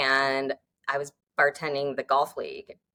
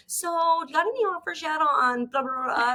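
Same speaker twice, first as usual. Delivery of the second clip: pushy client; 10 semitones higher than usual. Pretend. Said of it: "they're gonna be like".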